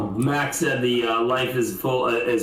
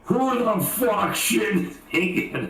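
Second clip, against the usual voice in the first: mock-angry dad, pitched higher; furious dad; 6 semitones higher than usual. Dad finding the mess in his paint can, furious. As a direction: imitate an outraged father demanding the culprit. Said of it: "And he's so furious".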